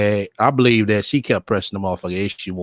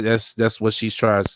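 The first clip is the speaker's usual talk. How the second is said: ordinary talk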